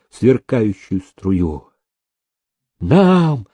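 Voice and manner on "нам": high, drawn-out clamour; crowd's cry. A narrator's reading. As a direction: call out greedily, second cry higher. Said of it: "закричали все разом".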